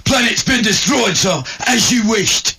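comic sergeant yelling news to Vader; shouting English sergeant. Retold or imitated. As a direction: bellow the report up at your lord. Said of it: "English sergeants".

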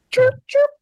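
chirp, chirp, high and bright like a bird; high chirping bird imitation. Playful laugh at the haiku, then a high bird chirp. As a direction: squeak it out like a little bird.

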